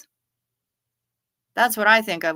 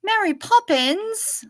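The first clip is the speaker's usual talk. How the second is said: put-on high, slow, posh English accent; a prim English nanny voice; Mary Poppins accent; 12 semitones higher, slower than usual. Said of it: "I'm not quite that good".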